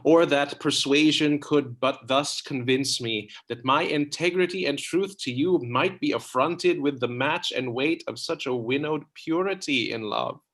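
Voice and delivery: ordinary talk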